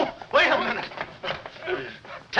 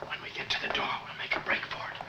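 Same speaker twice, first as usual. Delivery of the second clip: whispering; 8 dB quieter, faster than usual. Acted. Planning escape past the door.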